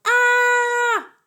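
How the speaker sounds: high, loud shriek of fright; father startled by his son, shrieking; startled yell. Acted. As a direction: scream like you've been ambushed. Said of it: "His father yelled".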